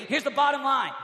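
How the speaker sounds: full-force preaching. Real, not acted. Preacher challenging high-school students about their campus, fervent. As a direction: drive every phrase home with force.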